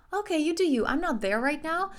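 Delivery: high and loud, brushing them off; mock lofty dismissal. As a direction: talk down from up high.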